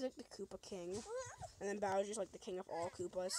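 ordinary talk, nothing marked

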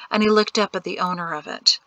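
ordinary delivery, nothing marked

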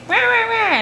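high mock squabbling noise; imitated bickering. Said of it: "pretty soon fighting breaks out".

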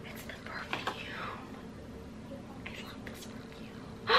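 breathless whisper of delight; hushed thrilled whisper; excited whisper. Real, not acted. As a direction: whisper it like a secret treasure. Unboxing a favourite perfume.

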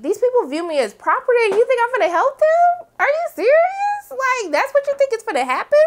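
high-pitched affronted questioning; incredulous. Acted. Acting out Rita refusing to help her captors, incredulous. Acts out her confronting him.

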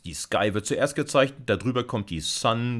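ordinary delivery

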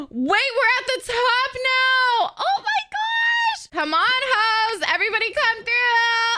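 high, loud, slow squealing; excited shriek. Real, not acted. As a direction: shriek with thrilled panic. Reaching the ski top.

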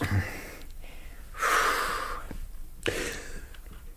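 long, weary drawn-out sigh. Acted. Holmes hears Watson plans to marry and sighs wearily. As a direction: let the breath go slowly, resigned.

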